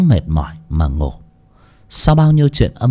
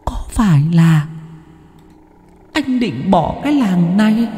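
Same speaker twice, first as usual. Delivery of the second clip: high, eerie reproachful ghost, drawn out slowly; wailing ghost voice; 14 semitones higher, slower than usual.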